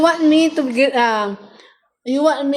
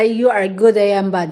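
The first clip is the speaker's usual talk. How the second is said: normal talking voice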